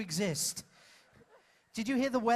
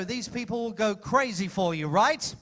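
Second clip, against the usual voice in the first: usual speaking voice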